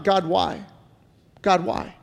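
hushed imploring God why. Retold or imitated. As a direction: ask it softly, as if worn down. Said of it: "how many of us have asked God".

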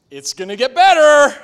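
bright, loud, upbeat mock reassurance; loud, sing-song "It's gonna get better"; Joyful Joe cheer. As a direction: chirp it like the relentlessly cheerful friend. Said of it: "they tell us".

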